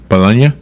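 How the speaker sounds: Bologna as "buh-LAHN-yuh", rising; trying Italian pronunciation. Shown as a demonstration. Host tests it on co-host, Bologna as "buh-LAHN-yuh?". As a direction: try Bologna with a questioning "-nya" ending. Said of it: "it's pronounced Bologna?".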